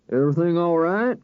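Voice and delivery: lightly voiced lawman checking a parked car; a hint of a wary deputy. A narrator's reading. Deputy peering into a parked car, lightly voiced wary lawman. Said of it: "the deputy asked".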